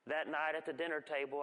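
usual speaking voice

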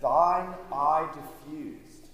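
very slow, drawn-out hymn line; reciting hymn lyrics. Acted. Preacher quoting Wesley's hymn, very slow and drawn out. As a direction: savour every word. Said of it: "And then he said".

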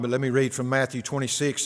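ordinary talk

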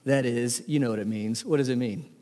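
normal talking voice

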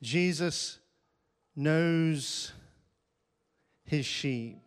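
"Jesus", "knows", "his sheep." each set apart by a pause; "Jesus knows his sheep." in three deliberate beats; word by word. Shown as a demonstration. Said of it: "let's get started".

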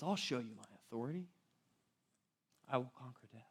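a quiet, weighty answer to the questioners; quiet solemn declaration. Retold or imitated. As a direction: say it softly, like a secret promise. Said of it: "And he says".

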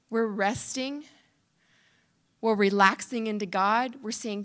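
ordinary talk

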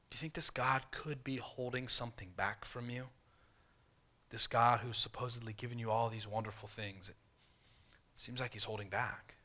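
soft, hushed, insinuating tempter voice; serpent's whisper. Retold or imitated. Preacher voicing the devil tempting Eve, softly and slyly. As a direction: lure her in with a quiet, coaxing hush. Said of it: "the devil, Satan, saying".

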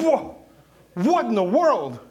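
loud, high cry of comic shock; startled yell. Acted. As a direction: jump back and yell it in disbelief. Startled man sees talking frog.

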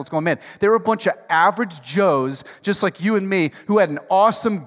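loud, driving sermon delivery; rising revival preacher; full-force preaching. Acted. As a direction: preach it out to the whole room.

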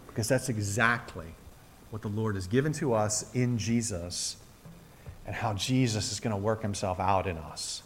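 hushed pastoral appeal; intimate quiet preaching. Acted. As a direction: draw the church in close and gently. Intimate close of the sermon.

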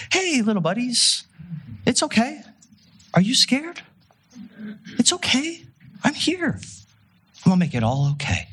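put-on very high, sing-song baby-talk reassurance; mock tender comfort. Imagined Jesus soothing scared disciples, hammed-up sweet high voice. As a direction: coo it like soothing a small child. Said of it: "soft and tender".

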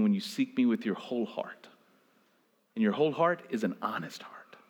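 low, soft, almost whispered sermon; hushed preaching. Acted. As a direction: draw them in with a hush.

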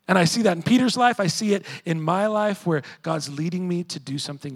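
loud, driving sermon voice; preaching at full force. Acted. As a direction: preach it hard to the congregation. Preacher surges again.

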